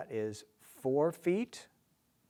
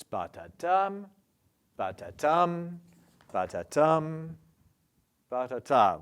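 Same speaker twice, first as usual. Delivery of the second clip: "Ba-ta-tum" with the last syllable loud and long; anapest rhythm; 3 semitones higher, 6 dB louder than usual. Shown as a demonstration. Teacher showing the triplet, punchy weak-weak-strong beat. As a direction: hit "tum" hard after two light syllables.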